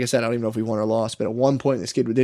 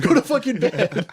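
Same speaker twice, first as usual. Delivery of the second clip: high, slow laughing mock order; coach ordering; 10 semitones higher than usual. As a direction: impersonate the coach while cracking up. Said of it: "And he's like".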